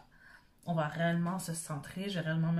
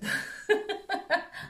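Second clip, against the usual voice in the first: short high embarrassed laugh; 13 semitones higher, 4 dB louder than usual. Real, not acted. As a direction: laugh at yourself, high and airy. Laughs at own translation.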